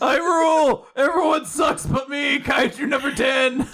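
high, loud boastful kaiju voice; cocky shouting brag. Pretend. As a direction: shout the brag like a smug monster.

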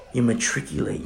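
normal talking voice

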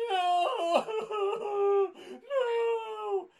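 high, loud cry of refusal through laughter; panicked shriek. Retold or imitated. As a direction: scream no like a terrified kid. Voicing his panicked younger self.

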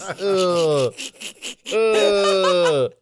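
long drawn-out groan of "ugh"; disgusted groan. Real, not acted. Co-host recoils from a crude joke, disgusted groan.

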